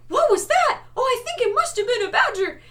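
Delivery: loud, very high stupid-sidekick voice; mocking high, dopey character voice; high-voiced dimwit. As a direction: squeak it out like the dim one of a duo. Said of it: "the high-voiced stupid one".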